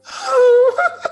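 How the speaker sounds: piercingly high, loud oh of joy; hammed-up shriek of delight; delighted squeal. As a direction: squeal at seeing a long-lost sister. Turns and greets sister with delight.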